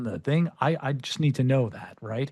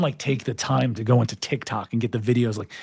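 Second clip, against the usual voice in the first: ordinary delivery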